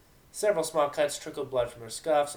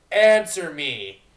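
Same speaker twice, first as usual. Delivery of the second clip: very loud, slow, higher roar; shouting; 4 semitones higher, 14 dB louder, much slower than usual. Acted. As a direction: roar the order at them. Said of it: "the captain shouted".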